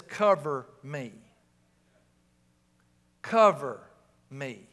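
low, slow, grave request; officer going in. Acted. Officer entering a dangerous house to partner, low and grave. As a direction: drop low and draw it out, like an officer before a raid. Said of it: "looks at the other one and says".

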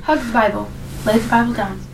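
low gentle voice. Acted. Gentle commands to Bible.

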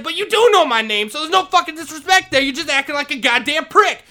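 angry yell. Retold or imitated. Janitor calling the principal a prick, angry yell. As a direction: call him out furiously. Retold shouting match with principal.

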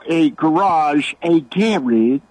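second GARAGE said British as GARE-idge; British pronunciation. Retold or imitated. Caller lists British words to host, contrasting pronunciations. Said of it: "where they call an attorney a solicitor".

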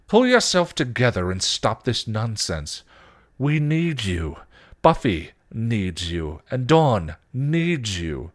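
loud, emphatic command, slowing down; stern order. Acted. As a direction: order it, dragging out each plea. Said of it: "Giles ordered".

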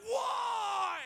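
high, loud, drawn-out outraged why; outraged yelp. Retold or imitated. Opening the photo radar bill, a high loud outraged wail. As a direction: wail it in mock outrage at the ticket. Preacher retells reacting to speeding bill.

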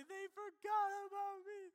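high, loud wailing whine; a kid's hammed-up pity party; mock sobbing. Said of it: "I remember sobbing".

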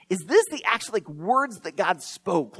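ordinary delivery

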